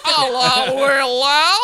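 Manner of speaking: loud, mangled Australian hello; Australian accent. Acted. Struggling through a greeting for friends, loud Australian accent. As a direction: call it out in broad mock Australian. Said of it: "my whole mouth has to go like this".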